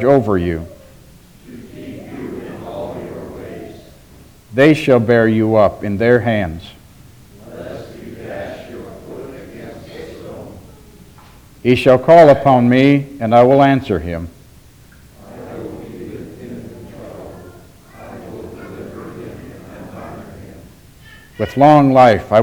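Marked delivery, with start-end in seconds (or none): 1.5-3.7 a crowd of voices murmuring the response; many voices in unison. Real, not acted. Congregation answers responsive psalm. 7.5-10.5 a crowd of voices murmuring the response; many voices in unison. Real, not acted. Congregation answers responsive psalm. 15.2-20.6 a crowd of voices murmuring the response; many voices in unison. Real, not acted. Congregation answers responsive psalm.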